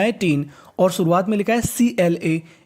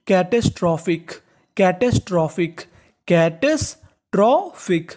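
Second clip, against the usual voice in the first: slow drawn-out; slower than usual. Shown as a demonstration.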